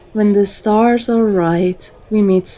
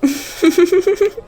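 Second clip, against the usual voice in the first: light high laugh; 10 semitones higher than usual. Acted. Lilith laughs at praise.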